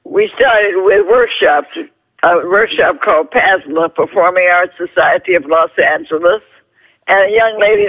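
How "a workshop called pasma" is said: ordinary delivery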